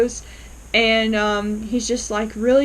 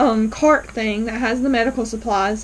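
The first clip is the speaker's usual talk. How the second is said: ordinary talk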